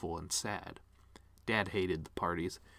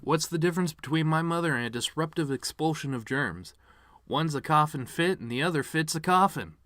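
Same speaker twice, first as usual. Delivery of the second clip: higher, louder punchy joke delivery; joke setup voice; 5 semitones higher, 5 dB louder than usual. Shown as a demonstration. Showing the piano man what a funny joke sounds like, punchy and loud. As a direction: sell it like a stand-up punchline. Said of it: "That's an example of a funny thing".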